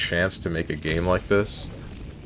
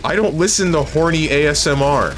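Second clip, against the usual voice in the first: higher-pitched, defensive protest; indignant denial; 6 semitones higher than usual. Real, not acted. Streamer denying a chatter's claim about ASMR, defensive and high.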